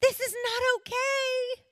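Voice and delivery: high, slow, pleading cry; anguished prayer. Retold or imitated. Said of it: "I cried out to the Lord".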